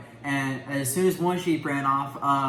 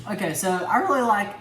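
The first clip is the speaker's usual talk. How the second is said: voice cracking; 8 semitones higher, 3 dB louder than usual. Real, not acted. Son answering his dad's question, voice cracking. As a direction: voice breaks upward mid-phrase. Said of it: "that was a terrible voice crack".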